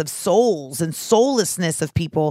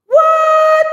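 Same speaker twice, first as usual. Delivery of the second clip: very high, loud squawk of disbelief; shocked what; 8 dB louder than usual. Pretend. Mocking a catfished partner, a high shocked shriek. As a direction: shriek it like you just caught a fake.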